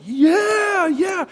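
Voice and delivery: high-pitched crowd cheer; imitated cheering kids. Homeschool kids answering their speaker, high mock cheering. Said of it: "they start yelling out".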